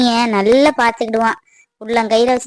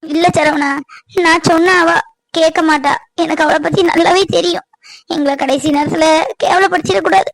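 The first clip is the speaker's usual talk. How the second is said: higher, tearful begging, voice catching in sobs; desperate tearful plea, pitched up; pleading through tears; 4 semitones higher than usual. Acted. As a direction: beg him while crying. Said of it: "அழாதீங்க அத்தை".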